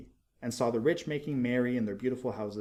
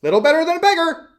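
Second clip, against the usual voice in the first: much higher, much louder, fast squeal; yes-men squealing their agreement; parroting councilors; 15 dB louder, faster than usual. A narrator's reading. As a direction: rush to echo the mayor. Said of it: "said the town councilors".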